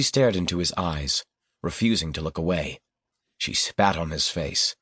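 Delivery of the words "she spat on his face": usual speaking voice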